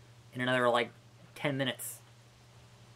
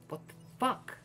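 whispered disbelief; 7 semitones higher, 7 dB quieter than usual. Real, not acted. Baffled by missing money.

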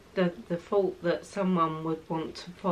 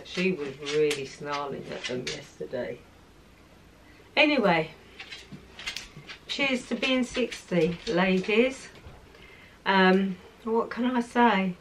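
ordinary talk, nothing marked

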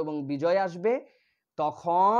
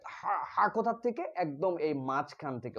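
ordinary talk, nothing marked

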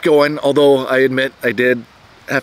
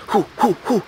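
runner whooshing by; 9 semitones higher, faster than usual. Retold or imitated. Runner blazing past him.

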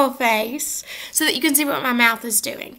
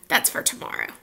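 whispered aside; 9 dB quieter, faster than usual. Acted.

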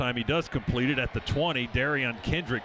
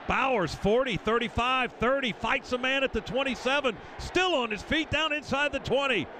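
rising higher yard by yard; building excitement; 7 semitones higher than usual. Real, not acted. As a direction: build the call as he runs. Long run called live.

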